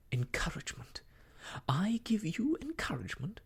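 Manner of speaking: incredulous echo rising to high indignation; indignant disbelief. A narrator's reading. Emma rejecting Mister Elton's claim, indignant disbelief. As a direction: throw the word back, then rebuke him.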